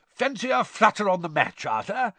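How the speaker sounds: high, eager betting offer; eagerly. Acted. Bagman urging Arthur to bet on the match, eager. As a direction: tempt him keenly to bet. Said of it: "he said eagerly".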